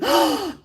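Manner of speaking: quick, audible intake of breath; surprised gasp. Real, not acted. Streamer learning it's a viewer's birthday, surprised gasp.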